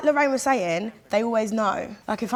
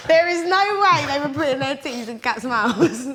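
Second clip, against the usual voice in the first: laughing; 4 semitones higher, 4 dB louder than usual. Real, not acted. Laughing disbelief at suckling cats.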